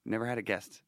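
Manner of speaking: ordinary talk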